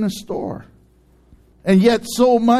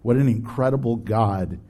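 prayer; 8 semitones lower than usual. Acted. Leads an opening prayer.

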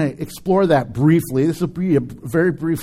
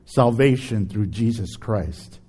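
prayer; 6 semitones lower than usual. Acted. Leads a closing prayer.

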